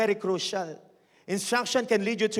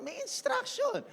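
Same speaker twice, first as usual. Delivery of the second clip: mock high voice; 4 dB quieter than usual. Mocking sing-song restatement.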